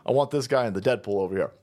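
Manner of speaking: usual speaking voice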